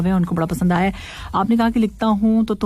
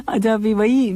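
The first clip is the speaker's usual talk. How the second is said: normal talking voice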